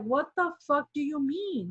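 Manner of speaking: indignant challenge. Retold or imitated. Member challenges the director's double standard, indignant. Retelling her outraged challenge in argument.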